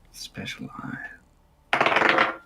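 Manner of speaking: whispered, mysterious "special eye"; dramatic whisper. Pretend. Player boasting of his special eye, whispering. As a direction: hush it like a secret power. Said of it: "dramatic whisper".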